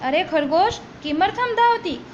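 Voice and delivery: ordinary talk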